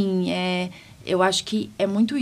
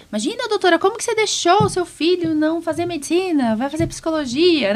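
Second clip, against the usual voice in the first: higher, louder mock outrage; scandalized acquaintance; 10 semitones higher, 4 dB louder than usual. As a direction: play a shocked friend scolding the doctor. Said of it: "ou fala".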